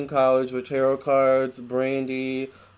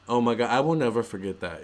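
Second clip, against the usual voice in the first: ordinary talk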